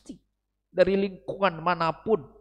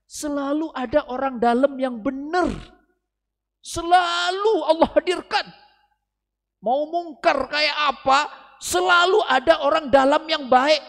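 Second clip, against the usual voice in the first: louder, higher preaching; 12 semitones higher, 10 dB louder than usual. Real, not acted. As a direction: proclaim it to the congregation. Khotbah membangun semangat. (berseru lantang dengan semangat dakwah)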